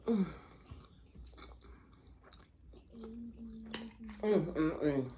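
chewing pizza; humming through food; mouth full. Real, not acted. Seen 1: Mouth full, cheese strand hanging from lips while humming.

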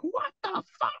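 high-pitched imitation of a shocked mom; shocked mother. Mother hearing son is leaving for the Marines, mock shock.

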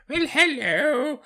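put-on high, slow old lady. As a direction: quaver it like a sweet granny. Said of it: "my old friend who's actually just an old lady".

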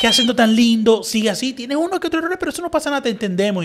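high-pitched, cooing praise; gushing encouragement. Retold or imitated. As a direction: encourage the foreigner in a high, effusive voice. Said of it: "es como que te motivan".

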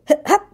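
a high squeaky hiccup. A narrator's reading. Roo interrupting Eeyore, squeaky hiccup. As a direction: hic out high and sudden. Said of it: "said Roo again".

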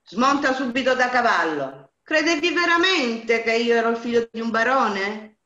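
loud, rough bandit barking orders; harsh shout. A narrator's reading. Bandit chief turning on his bride, harsh shout. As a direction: yell it at her like a brute. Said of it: "le gridò in modo brusco".

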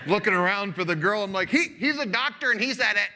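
loud, high, laughing disbelief; excited amazement. Retold or imitated. Preacher retelling his amazement at the doctor agreeing, excited and loud.

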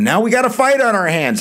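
high, loud rallying call to the class; excited. Real, not acted. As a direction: fire the class up for the fight. Teacher hypes up the coming fight.

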